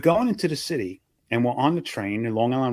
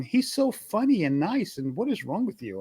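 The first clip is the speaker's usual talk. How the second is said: sweet cigar lady; 7 semitones higher than usual. Retold or imitated. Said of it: "she's going".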